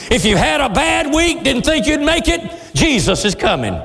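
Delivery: high, rousing comfort; fervent exhortation. Acted. Preacher comforts weary congregation, high rousing exhortation. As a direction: lift up the discouraged.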